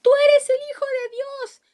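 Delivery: screaming demons in the gospel passage; demons crying out. Acted. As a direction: shout it like a demon shrieking. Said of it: "dando voces".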